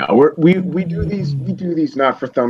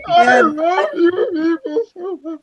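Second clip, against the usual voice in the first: laugh-crying; slower than usual. Real, not acted. Overjoyed at the teasing.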